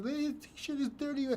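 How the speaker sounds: high, soft mocking quote of a viewer; mock commenter voice. To viewers, mimicking a snide comment with a high, soft voice. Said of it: "if you're making fun of this".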